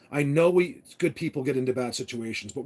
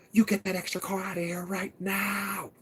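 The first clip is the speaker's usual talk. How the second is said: put-on stern old lady ordering, a bit higher; imitating her order; 5 semitones higher than usual. Ranch owner ordering the guy's car gone, mock stern. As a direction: mimic her bossy command to move the car. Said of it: "This is how she talks".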